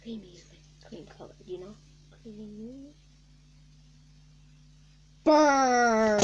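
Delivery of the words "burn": loud cartoon shout. Toy stunt, shouted.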